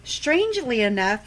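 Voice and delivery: normal talking voice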